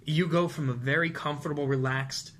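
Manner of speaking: ordinary delivery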